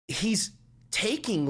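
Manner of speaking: usual speaking voice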